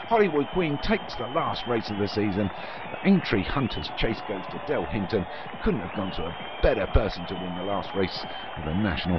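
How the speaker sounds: high and excited, settling down; triumphant winner call. Real, not acted. Commentator announcing the season's last winner, excitement easing. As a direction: announce the win, then come down from the climax.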